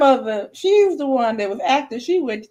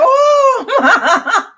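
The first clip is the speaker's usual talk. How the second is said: grandma's shocked voice; 14 semitones higher, 5 dB louder than usual. Retold or imitated. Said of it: "The pastor in grandma's voice".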